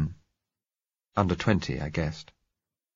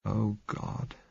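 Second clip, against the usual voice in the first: softly; 3 semitones higher, 6 dB quieter than usual. Acted. Narrator softly answering the blind girl's plea. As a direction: let it out under your breath. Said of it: "I said softly".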